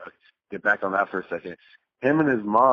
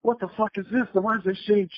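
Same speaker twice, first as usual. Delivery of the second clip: much higher, mock disgusted outrage; shocked outburst; 11 semitones higher than usual. Retelling finding dirty paper in his trash, shocked and higher. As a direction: pitch it way up like a grossed-out teen.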